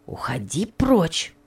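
slow, whispered, firm dismissal; firm whispered order. A narrator's reading. Firm slow whisper. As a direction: maid firmly sends Topsy away, whispered. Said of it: "повторила Роза ещё более решительно".

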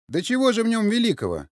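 high, loud scoffing question; mocking. A narrator's reading. Sergei interrupts to deride the old man's ancestor, mocking. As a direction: cut in with open derision. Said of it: "насмешливо спросил Сергей".

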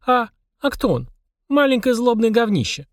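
put-on high-pitched kid's voice; children's voices. A narrator's reading. Children asking and answering about the demon, high childlike voice.

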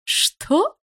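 high, loud incredulous yelp; flabbergasted, shrieked question; stunned. A narrator's reading. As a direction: react in sheer disbelief, pitch leaping up. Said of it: "ошалела та".

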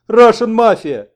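loud, high, drawn-out scream; wild yelling. A narrator's reading. Wounded American yells at those grabbing him, wild yelling. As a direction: yell it slowly at full force. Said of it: "завопил тот".

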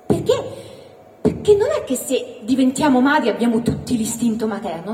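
ordinary talk

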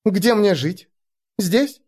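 put-on high young girl asking. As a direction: ask up brightly, like a girl. Elfie voiced by narrator.

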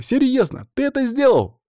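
put-on very high, incredulous girl's voice; astonished. A narrator's reading. Katya to Stas over his loot, amazed. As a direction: ask in squeaky disbelief. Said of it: "ахнула Катя".

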